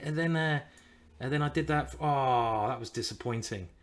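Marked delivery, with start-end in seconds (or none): none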